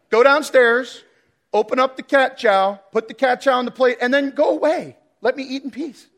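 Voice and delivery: lightly voiced demanding cat; bossy cat voice. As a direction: play the cat bossing its owner around. Cat's demanding morning orders voiced.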